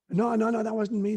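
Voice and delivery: hammed-up retold denial; protesting denial. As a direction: deny it hurriedly, playing the cornered man. Acting Peter's flustered denial.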